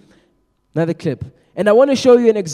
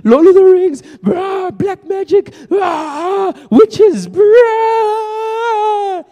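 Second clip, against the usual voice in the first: high, frantic mock rant, near shouting; hammed-up overzealous believer ranting; 11 semitones higher, 6 dB louder, much slower than usual. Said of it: "we wanna go out there and be like".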